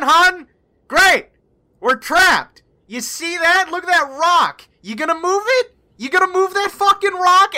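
high, loud sarcastic rant; pissed off. Acted. Howland rails at the woman who trapped them, pissed-off sarcasm. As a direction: bite off each word in acted, exasperated fury. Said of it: "he's pissed".

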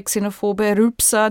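ordinary talk

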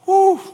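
loud, high whew of release; whew exhale. Real, not acted. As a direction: blow out after the big climax. Exhale after preaching peak.

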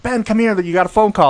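mock a receptionist calling across the office; retold yell. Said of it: "she, she yelled".

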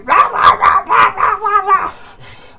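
long, high babbling yell; mock kid screaming. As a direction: imitate kids shrieking over the threat. Imitates kids screaming when threatened.

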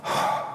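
a long, soft, heavy sigh; quiet sorrowful exhale; demonstrated sigh. Shown as a demonstration. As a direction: sigh out the sadness, audibly. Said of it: "'Cause they're like".

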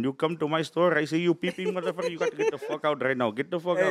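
indignant Indian-accented store clerk; 7-Eleven clerk. Shown as a demonstration. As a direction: chase out a shoplifter in an Indian accent. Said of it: "I got seven eleven down".

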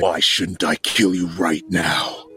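slow, threatening brute voice; a slow, measured threat; menacing brute. Acted. Taz threatening Chaldea while choking her, slow and menacing.

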